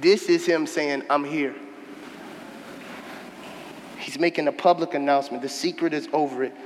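soft, low, quiet declaration; hushed emphasis. Acted. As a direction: lean in and drop the voice.

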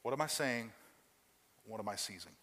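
ordinary talk, nothing marked

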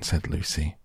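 usual speaking voice

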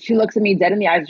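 usual speaking voice

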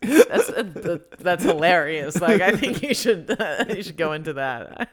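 cracking up mid-sentence; laughing. Real, not acted. Co-host reacts to a friend's accent confession, laughing.

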